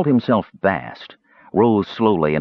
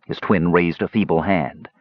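plain reading voice

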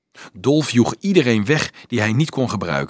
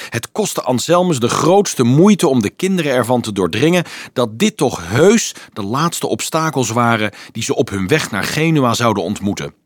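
usual speaking voice

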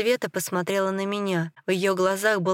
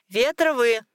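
higher-pitched nurse's call; raised summoning voice; 5 semitones higher, 4 dB louder than usual. A narrator's reading. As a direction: call the family over. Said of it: "позвала медсестра".

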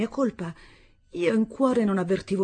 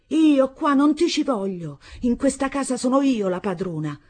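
loud, high out-of-control yelling; beside herself; 7 semitones higher, 5 dB louder than usual. A narrator's reading. Stepmother beside herself, screaming she is mistress here. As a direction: claim the house as hers, raging. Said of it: "Seguitò addirittura fuori di sé".

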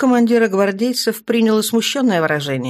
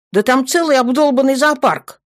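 higher-pitched exasperated grumble; grumbling; 8 semitones higher, 3 dB louder than usual. A narrator's reading. Guardsman reports on the crowd downstairs, grumbling. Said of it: "буркнул гвардеец".

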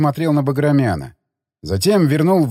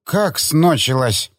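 put-on slow, garbled drunk question; drunken slur; slower than usual. Drunk man hearing the bottle is empty, drunken slur. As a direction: mangle it like a sulky drunk. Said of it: "На пьяном, на пьяном языке".

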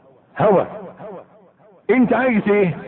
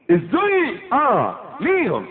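usual speaking voice

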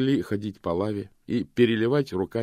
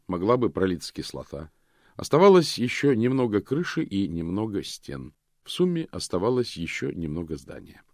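usual speaking voice

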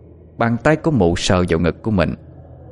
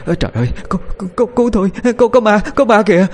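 high-pitched terrified stammer, building louder; panicked pleading; 12 semitones higher, 3 dB louder, faster than usual. A narrator's reading. Woman begs for help after seeing a ghost, frantic pleading. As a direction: stutter frantically, climbing into a scared cry. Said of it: "rồi hốt hoảng van xin".

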